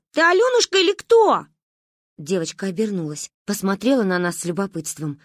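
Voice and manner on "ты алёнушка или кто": high loud shouted question; calling question. Acted. Kids shout their question at the silent girl. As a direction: demand her name from afar.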